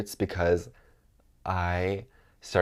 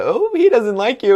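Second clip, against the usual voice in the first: teasing with a laugh; 12 dB louder than usual. Retold or imitated. Stud teasing her, retold.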